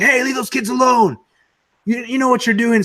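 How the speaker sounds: high, loud mock yell; yelling New Yorkers. New Yorkers yelling at the seller to leave the kids alone, high and loud. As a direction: shout him away from the kids. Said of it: "started yelling at him and stuff".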